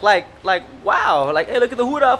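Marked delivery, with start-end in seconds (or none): none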